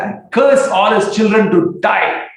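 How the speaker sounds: high, loud, slow furious curse. Retold or imitated. Nityananda cursing the family in a rage. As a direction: thunder out the curse.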